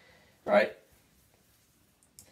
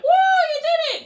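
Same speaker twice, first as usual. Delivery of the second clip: much higher, loud whooping cheer; cheering crowd; 7 dB louder than usual. Retold or imitated. Crowd cheering the winner on the livestream, high whoop. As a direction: cheer like a thrilled fan.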